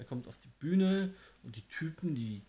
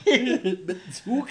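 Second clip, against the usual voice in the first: laughing; 9 semitones higher, 9 dB louder, much slower than usual. Real, not acted. Laughing slow guess.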